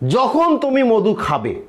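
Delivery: ordinary talk, nothing marked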